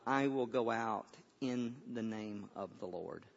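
low, hushed quotation of David; hushed, reverent delivery; solemn quiet declaration. Retold or imitated. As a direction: declare it softly and gravely, unhurried. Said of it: "here's what David says".

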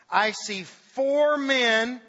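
the king cries out in amazement, slow and loud; shouted. Acted. King shouting at advisors, slow astonished cry. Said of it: "Nebuchadnezzar shouted".

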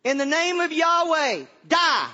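high, loud pretend incantation; hammed-up battle curse; mock curse-casting. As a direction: shout it like a magic spell. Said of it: "Is it some kind of magic syllables".

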